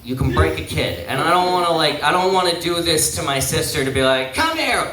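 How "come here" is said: higher-pitched playful call; acted beckoning. Uncle reaching for his niece, acted higher call. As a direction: call a toddler over, pitch jumping up.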